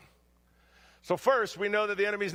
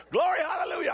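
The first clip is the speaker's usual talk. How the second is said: frantic yell; 9 semitones higher, faster than usual. Retold or imitated. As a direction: cry it out in mock terror. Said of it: "I'm just yelling stuff out".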